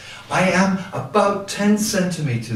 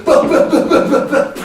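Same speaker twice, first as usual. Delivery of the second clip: loud, high percussive bah-bahs; imitated mic tapping; 10 semitones higher, 6 dB louder, faster than usual. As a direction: mimic thumps on a live microphone. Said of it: "And then they go".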